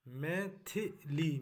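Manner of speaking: मैथिली said very slowly, syllable by syllable; teacher dictating मैथिली slowly; careful spelling demo. Shown as a demonstration. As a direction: spell out मैथिली slowly, each sound clear. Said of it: "मैथिली, मैथिली नहीं होता है".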